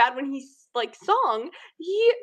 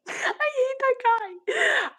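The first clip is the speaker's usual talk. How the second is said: dramatic disgust; 10 semitones higher, slower than usual. Real, not acted. Stretched dramatic hatred.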